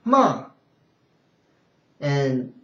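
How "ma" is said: MA falling hard and loud from high; fourth tone. Shown as a demonstration. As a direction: drop MA sharply from the top.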